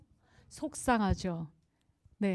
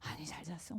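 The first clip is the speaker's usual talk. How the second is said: muttered dismissal; 5 semitones lower, 17 dB quieter than usual. Retold or imitated. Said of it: "이거 해주면 되는데".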